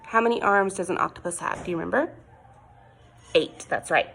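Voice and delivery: usual speaking voice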